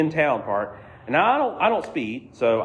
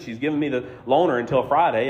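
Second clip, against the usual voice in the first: normal talking voice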